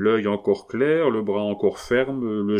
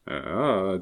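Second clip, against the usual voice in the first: usual speaking voice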